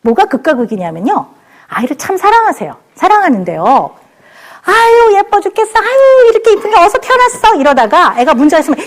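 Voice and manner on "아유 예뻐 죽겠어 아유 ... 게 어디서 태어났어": put-on high, gushing coo over a baby; gushing doting mom. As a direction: melt over the child, voice up high. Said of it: "뭐 이렇게 해요".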